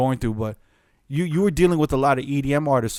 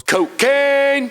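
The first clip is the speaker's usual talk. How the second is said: much higher, loud DJ-drop yell; DJ drop shout; 12 semitones higher, 6 dB louder than usual. Shown as a demonstration. Host demoing his own DJ drop for the guests, loud shout. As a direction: belt it like a club air horn drop. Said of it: "I have a drop that goes".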